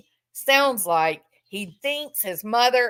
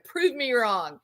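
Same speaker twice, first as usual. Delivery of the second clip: ordinary talk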